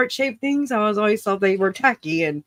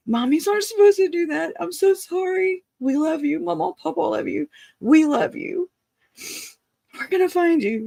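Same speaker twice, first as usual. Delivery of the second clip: put-on high, soothing words to a hurt child; coddling; 6 semitones higher than usual. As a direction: comfort him like a frightened little boy. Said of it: "I started to coddle him and say".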